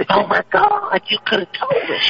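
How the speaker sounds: high squealing complaint; hammed-up shocked squeal; mock shock. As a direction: squeal it in fake betrayal.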